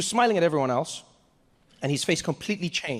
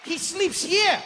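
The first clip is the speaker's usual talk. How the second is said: very high, excited tour guide; overjoyed guide showing off the sights; Deng Basan; 12 semitones higher than usual. Acted. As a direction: announce it proudly in a high pitch. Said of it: "and he's like".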